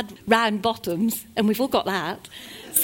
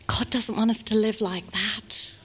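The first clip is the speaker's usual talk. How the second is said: ordinary delivery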